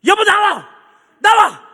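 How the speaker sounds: high, loud acted holler for his wife; booming mock command; retold shout. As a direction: yell it out as the impatient old husband. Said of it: "막 소리 지르니까".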